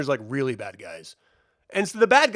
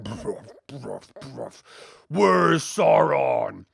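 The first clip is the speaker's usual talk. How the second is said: mock troll grunt; 5 semitones lower, much slower than usual. Imitating troll wandering in.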